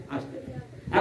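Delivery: breathy whisper of the echo line; a whispered aside. Shown as a demonstration. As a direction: drop to a whisper on the return stroke.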